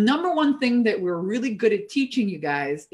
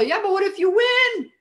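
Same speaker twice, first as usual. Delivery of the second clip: much higher, louder challenge; excited challenge; 11 semitones higher, 4 dB louder than usual. Real, not acted. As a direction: throw it back with energy. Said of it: "what if I fail".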